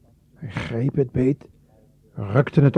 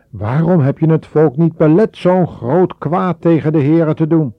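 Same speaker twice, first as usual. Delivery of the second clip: higher, louder, furious reproach; wrathful rebuke; 7 semitones higher, 8 dB louder than usual. A narrator's reading. Mozes rebuking Aaron, higher and furious. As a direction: let the anger boil over. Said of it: "Bevend van toorn".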